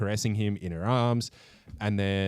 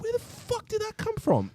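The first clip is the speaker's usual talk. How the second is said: shocked disbelief, pitched way up; stunned outburst. Retold or imitated. Viewer reacting to a sudden twist, shocked high outburst. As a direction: blurt it out like a viewer caught off guard. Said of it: "You're like".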